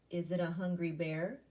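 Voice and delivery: ordinary talk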